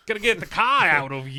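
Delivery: loud mock Boston accent. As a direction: ham up a thick Boston accent.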